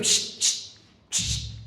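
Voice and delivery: unvoiced hushing call; imitated hushing. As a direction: hiss for someone's attention. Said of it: "nos dice". (siseo para llamar la atención)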